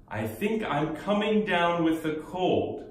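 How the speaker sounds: very slow teaching read; very slow model sentence. Shown as a demonstration. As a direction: drag each word out for learners. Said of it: "감기 기운 조금 있는 것 같아요".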